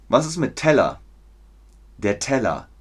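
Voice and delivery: ordinary talk, nothing marked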